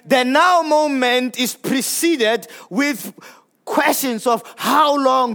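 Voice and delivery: ordinary talk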